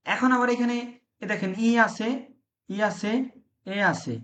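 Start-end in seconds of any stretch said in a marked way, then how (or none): none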